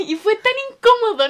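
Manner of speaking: loud, high-pitched burst of laughter; helpless laughing. Real, not acted. Recalling the awkward shared-ex moment, laughing hard. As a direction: crack up and squeal through the words.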